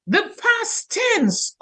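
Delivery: high, slow "The past tense"; drawn-out emphasis. Shown as a demonstration. Teacher announcing the tricky topic, drawn out. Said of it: "oh my goodness".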